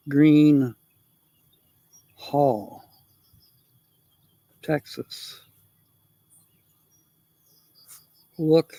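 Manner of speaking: word by word with long pauses; dictating to self. Real, not acted. Talking to self while writing down the town, dictating haltingly. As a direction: say it piece by piece as you write.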